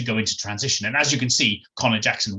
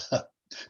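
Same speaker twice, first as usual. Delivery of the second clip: nervous laugh; 8 dB quieter than usual. Acted. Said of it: "composed herself".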